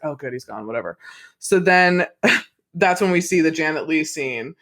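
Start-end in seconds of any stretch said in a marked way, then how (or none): 0.0-1.0 low, soft throwaway relief; mock relief. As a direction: toss off the quoted relief quickly under your breath. Voices character's relief, brushed off.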